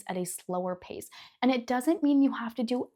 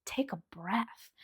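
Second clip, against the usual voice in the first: whispered, slow take a breath; whispered calming advice; 9 dB quieter, slower than usual. Retold or imitated. Vlogger whispering calm advice to a hectic person, slow and soft. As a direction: whisper it gently to calm them. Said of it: "you just wanna tell 'em".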